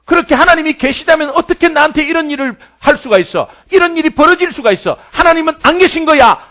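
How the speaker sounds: loud, high cry that God is absent; despairing outcry. Acted. Preacher voicing Paul's despair, loud and high. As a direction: cry it out as a man who thinks God has left him.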